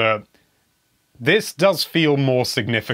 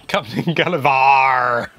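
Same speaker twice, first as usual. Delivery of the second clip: grand pirate announcement; 4 dB louder, much slower than usual. Pretend.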